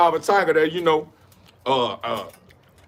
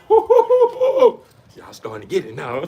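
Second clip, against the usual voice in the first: laughing; 5 semitones higher, 3 dB louder, slower than usual. Real, not acted. Laughs at own point.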